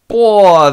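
high, very loud boah; relieved exclamation. Acted. Oedipus relieved his father died naturally, very loud. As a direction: blow out in relief. King hears father dead.

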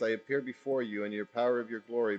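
ordinary delivery

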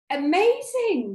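high, loud, stretched wonder; amazed monster. Acted. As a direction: marvel with drawn-out astonishment. Gruffalo is amazed.

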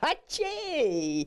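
high, drawn-out merry cry; jolly high-pitched exclamation; gleeful call. A narrator's reading. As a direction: call it out playfully while dancing. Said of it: "danste door de kamer en riep".

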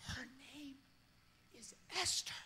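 soft, high, halting disclosure; hushed reveal. Retold or imitated. Bystander explains why she weeps, hushed reveal. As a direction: let the name out in a hush. Man reveals the woman's name.

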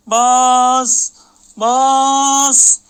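loud, urgent one-word shouts; excited shout. Real, not acted. Gamer pressing to open the box, loud excited shout. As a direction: hammer the word out as you press.

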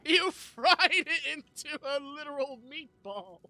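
loud, high, slow amazed shout; excited shout. Real, not acted. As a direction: shout it out in delight. Amazed at friend's attack.

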